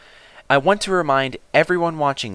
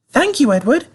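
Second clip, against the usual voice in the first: high, fussy coach voice; a much higher voice for the anxious coaches; 3 dB louder than usual. A narrator's reading. Coaches warning Edward not to bump them, high and fussy. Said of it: "said the coaches".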